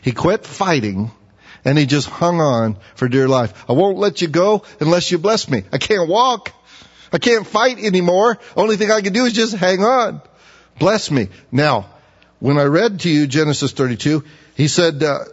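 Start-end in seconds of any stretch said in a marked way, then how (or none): 3.7-11.3 pleading Jacob. Acted. Preacher voices Jacob pleading.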